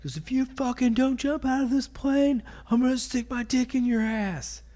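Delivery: high-pitched mock sergeant tirade; drill sergeant. Sergeant cursing at the recruit in the plane, high mock rant. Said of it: "you know how sergeants can be".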